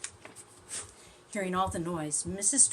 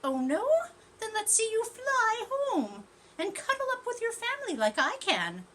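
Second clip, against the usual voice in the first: much higher, louder bird voice; taunting bird; 6 dB louder than usual. Acted. Bird taunting the tortoise about flying, high. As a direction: tease her like a smug little bird.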